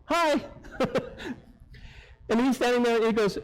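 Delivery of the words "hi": a high, awkward little hi through a cracked window; sheepish hi. Shown as a demonstration. Nervous guest greeting the tuxedoed valet, high and awkward. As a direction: greet him through the barely open window, squeaky and embarrassed. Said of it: "it looked something like this".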